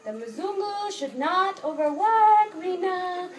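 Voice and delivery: high, slow, insistent mock scolding; host sister telling her guest not to overwork, in a high slow imitation; imitating host sister. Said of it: "she would always say something to me like".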